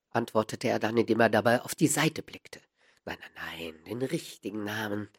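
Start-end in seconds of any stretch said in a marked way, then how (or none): 3.0-5.1 low, soft, gentle correction; friendly. A narrator's reading. Holmes asking the small man for his true name, soft and friendly. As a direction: coax quietly and kindly. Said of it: "sagte Holmes freundlich".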